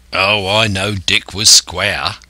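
slow seaman's voice, offhand; rough-and-ready sailor's dialect; coxswain. Acted. Israel Hands answering Silver, as an offhand seaman. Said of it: "returned the voice of the coxswain".

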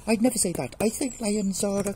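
high mouse voice, hurried excuse; protesting mouse. Acted. Caught mouse excusing itself to lion, protesting. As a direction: plead innocence in a high voice.

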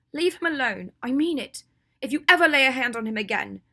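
loud, high, protective threat; fierce warning. Acted. Hermione shields Draco from Ginny, threatening her fiercely. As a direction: warn her off with full force.

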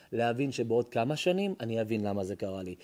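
ordinary talk

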